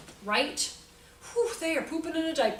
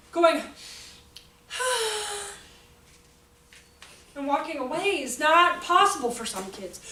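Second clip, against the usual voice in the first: ordinary delivery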